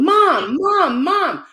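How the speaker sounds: high, loud imitated call for Mom; retold yelling. Sick mother suddenly calls from her bed, imitated yelling. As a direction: yell it as the mother calling out. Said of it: "my mother starts yelling".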